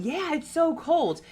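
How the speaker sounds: high, drawn-out imitation of agreement; hammed-up freaked-out reply; mock freak-out. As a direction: whine it high and slow, mimicking. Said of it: "Zak is freaking out".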